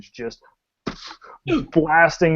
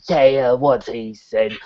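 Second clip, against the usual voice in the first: slow, lightly voiced creature; a hint of a dim monster, drawn out; slow monster-head voice; monster's second head; 2 semitones lower, slower than usual. Monster's other head talks.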